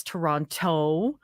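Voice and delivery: "Toronto" slowly, with both t sounds; fully pronounced Toronto. Shown as a demonstration. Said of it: "like a lazy tongue".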